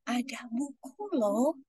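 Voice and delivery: slow, soft secret whisper. Shown as a demonstration. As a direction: tease the kids with a hushed secret. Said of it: "kita mulai dari bisik-bisik". (berbisik pelan dan lambat)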